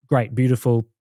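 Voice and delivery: ordinary delivery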